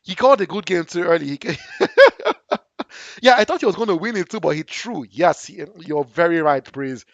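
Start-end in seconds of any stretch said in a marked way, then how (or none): 1.6-2.6 laugh. Real, not acted. Laughs at early good game.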